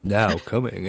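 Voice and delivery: low homemade radio announcer voice. Shown as a demonstration. Showing off his own radio voice, low announcer. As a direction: drop low like a DJ going on air. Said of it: "I like coming up with my own radio voice".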